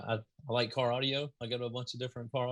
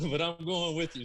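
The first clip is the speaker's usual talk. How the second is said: laughing through a joking quote; 5 semitones higher, 4 dB louder than usual. Retold or imitated. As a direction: play your old self, half laughing. Retold creeper-mode quote, laughing.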